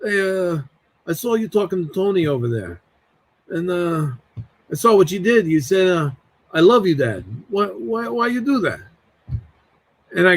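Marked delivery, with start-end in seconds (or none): 0.0-8.8 lightly voiced Italian uncle, questioning; put-on uncle voice, halting then rising into the challenge; Uncle Freddie. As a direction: imitate a serious uncle, pitch rising on the final question. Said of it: "I've always thought he sounded like this".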